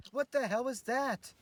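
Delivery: high, slow alarmed cry; startled shout. Acted. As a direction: jump at the bang and yell. Startled by gunshot.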